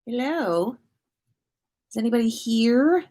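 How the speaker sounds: normal talking voice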